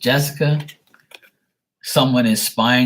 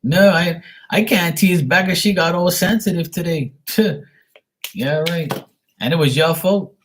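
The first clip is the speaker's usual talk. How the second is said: excited teasing; 8 semitones higher than usual. Real, not acted. Animated teasing, higher and louder.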